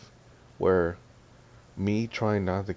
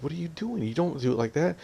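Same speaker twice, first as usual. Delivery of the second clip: high-pitched retold objection; mildly exasperated mock scolding; indignant scolding; 9 semitones higher than usual. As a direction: act out a bickering protest, pitch jumping up. Retold quarrel over the leash.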